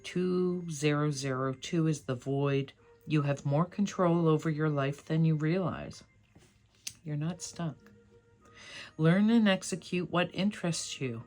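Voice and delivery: usual speaking voice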